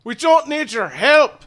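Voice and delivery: loud, high angry shout; angry rebuff. Acted. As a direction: snap back in fury. GM character rejects angrily.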